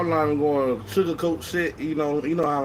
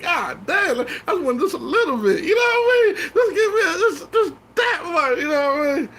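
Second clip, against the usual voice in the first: high, whiny fake sobbing; hammed-up crying plea, pitched way up; mock crying plea; 12 semitones higher, 4 dB louder than usual. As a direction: wail it like a begging crybaby. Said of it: "I'm like".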